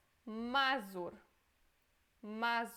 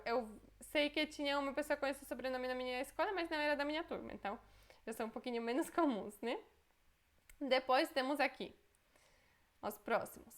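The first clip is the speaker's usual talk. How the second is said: normal talking voice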